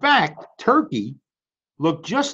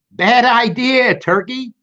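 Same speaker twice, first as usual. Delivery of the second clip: high, slow, louder rebuke; rooster's slow scolding; 8 semitones higher, 4 dB louder, slower than usual. Acted. Rooster telling Turkey off, high and slow. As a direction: wag a finger with it.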